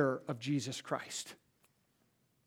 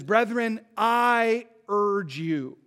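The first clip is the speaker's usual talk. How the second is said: earnest plea; 3 semitones higher, 5 dB louder, much slower than usual. Retold or imitated. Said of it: "And he says".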